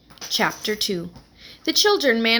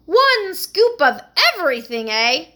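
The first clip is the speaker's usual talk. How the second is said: amused New York echo, much higher and louder; New York accent; 8 semitones higher, 7 dB louder than usual. Acted. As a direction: tease the boy in a gum-chewing New York voice. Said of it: "her New York accent".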